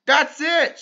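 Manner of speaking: high, loud triumphant shout; excited scream. Acted. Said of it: "screamed Arthur".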